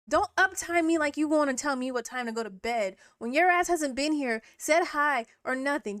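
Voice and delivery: heated rant at a viewer; angry scolding. Real, not acted. Scolding chat member.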